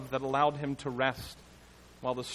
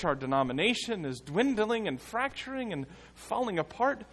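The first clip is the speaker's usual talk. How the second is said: higher, pleading questions to the Lord; pleading prayer; 6 semitones higher than usual. Acted. Preacher voicing a believer's plea to a sleeping Lord. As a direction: beg him to care, rising each time. Voicing our own cries to God.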